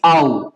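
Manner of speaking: आउ as one low gliding "ow"; low, distinct आउ for German au; joined AU sound. Shown as a demonstration. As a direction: glide A into U: आउ. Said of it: "क्या होगा".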